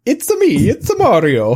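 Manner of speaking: put-on jokey Italian accent, high at the start; cartoon Italian accent for the kids. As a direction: do a silly Mario-style Italian accent. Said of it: "I just put on an Italian accent".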